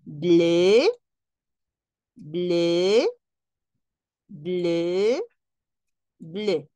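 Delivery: bleu said four times the same, French vowel. Shown as a demonstration. Teacher has class listen to bleu in French. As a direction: repeat bleu identically each time. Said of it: "¿Escuchan?".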